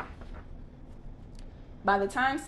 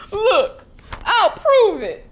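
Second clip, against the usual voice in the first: much higher and louder, slowing on prove it; desperate insistence; 13 semitones higher, 11 dB louder, much slower than usual. Acted. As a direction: plead to be believed. Pumbaa insists, close to tears.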